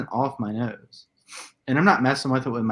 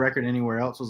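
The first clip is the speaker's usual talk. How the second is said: ordinary talk, nothing marked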